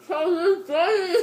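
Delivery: loud, slow, mouth packed full; cheeks stuffed full. Real, not acted. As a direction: strain words through a crammed mouth.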